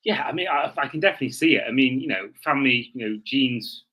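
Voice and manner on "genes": ordinary talk, nothing marked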